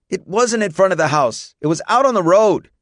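put-on high-pitched boy's yelled protest; high, indignant yell. A narrator's reading. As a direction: yell a heated correction. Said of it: "Jacob yelled".

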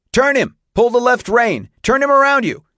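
high, loud coaching shouts; urgent shouted orders. A narrator's reading. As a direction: shout instructions to a rider in trouble. Urgent orders during horse runaway.

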